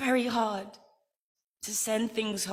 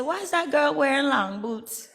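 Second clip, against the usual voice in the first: put-on little kid's question; 6 semitones higher, 7 dB louder than usual. Kid asking about the boots.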